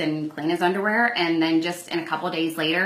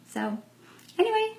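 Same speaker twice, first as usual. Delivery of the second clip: bright sign-off; 11 semitones higher, 4 dB louder than usual. Real, not acted. Cheerful sign-off to viewers.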